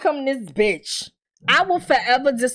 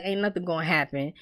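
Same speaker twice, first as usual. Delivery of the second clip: low, quiet and offhand; dismissive boyfriend voice; 8 semitones lower, 8 dB quieter, faster than usual. Retold or imitated. Boyfriend brushing off his girlfriend's fear, low and quiet. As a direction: brush her worry off coolly. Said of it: "And he's like".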